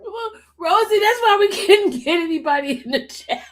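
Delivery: tearful laughing voice; crying with laughter. Real, not acted. Streamer telling Rosie about the empty chat, crying with laughter. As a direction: talk while cracking up for real. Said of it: "They got me crying over here".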